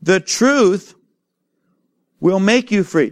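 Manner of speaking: "truth" held slow and weighty; emphatic. Shown as a demonstration. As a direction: land "the truth" like a verdict. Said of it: "now listen to how powerful this is".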